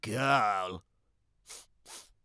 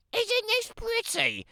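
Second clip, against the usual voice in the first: put-on much higher, sing-song girlish voice; a sweet, self-admiring child's voice; vain little girl. Little Red voiced by narrator.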